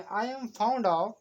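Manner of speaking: very slow, every word of I am fond of spaced apart; slow model sentence. Shown as a demonstration. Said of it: "तो बोले".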